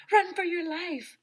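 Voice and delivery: high, loud panicked shout; loud storybook alarm cry. A narrator's reading. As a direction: yell a warning at top pitch. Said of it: "shouted Ryan".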